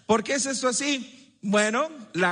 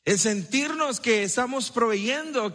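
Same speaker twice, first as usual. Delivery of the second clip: ordinary talk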